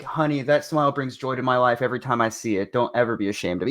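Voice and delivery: sweet reassurance. Retold or imitated. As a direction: comfort her gently. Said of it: "something sweet".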